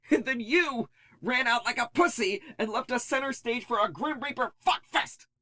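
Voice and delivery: high, strained furious tirade; shrill outrage at the coward who left; furious rant. Acted. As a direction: tear into him for abandoning them. Nix furious at the narrator.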